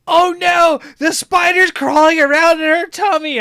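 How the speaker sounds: put-on high, loud frightened child cry; panicked child. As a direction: wail it like a scared little sister. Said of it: "Cece was like".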